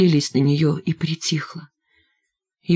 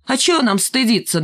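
high, cheeky yap; cheeky high retort; 6 semitones higher than usual. A narrator's reading. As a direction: talk back insolently. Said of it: "нахально вякнул Валька".

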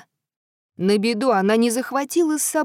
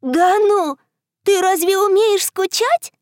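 put-on little girl voice; 7 semitones higher, 4 dB louder than usual. Girl talks to the moon.

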